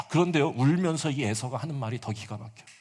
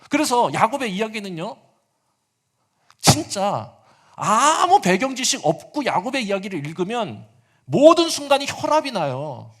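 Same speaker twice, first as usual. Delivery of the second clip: loud, rising sermon delivery; impassioned preacher building to a climax; preaching at full force; 9 semitones higher, 9 dB louder than usual. Acted. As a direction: drive the point home to the congregation.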